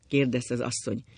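ordinary delivery

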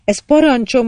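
put-on loud, high child's command. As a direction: order it like a little prince. Child prince gives an order.

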